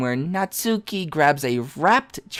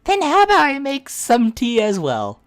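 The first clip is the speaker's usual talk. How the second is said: put-on gentle girl voice; 10 semitones higher than usual.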